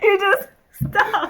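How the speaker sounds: high, loud, drawn out through laughter; really laughing, helpless protest; cracking up. Real, not acted. Cracks up at the name.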